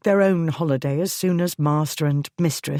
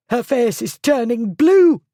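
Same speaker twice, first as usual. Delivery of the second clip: high, louder panicked scream; 8 semitones higher, 6 dB louder than usual. A narrator's reading. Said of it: "the schoolmistress screamed".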